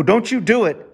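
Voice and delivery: mock-scold the cat before he jumps; retold warning to the cat. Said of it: "I go".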